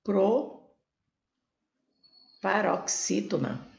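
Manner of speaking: proparoxítona with a long pause after PRO; suspenseful pause. Shown as a demonstration. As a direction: say PRO, wait, then finish proparoxítona slowly. Teacher drawing out the answer. (proparoxítona com pausa longa depois do PRO)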